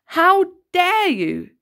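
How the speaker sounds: slow, loud, high teacher's shout; enraged teacher stopping a fight; furious shout. A narrator's reading. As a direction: bellow it at the two boys. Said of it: "shouted Missus Collins".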